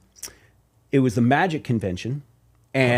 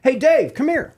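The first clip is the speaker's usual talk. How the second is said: much higher, loud summoning call; calling over; 11 semitones higher, 3 dB louder, slower than usual. Retold or imitated. Label president summoning the drummer, much higher loud call. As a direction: call the drummer across the room. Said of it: "Bob Jameson goes".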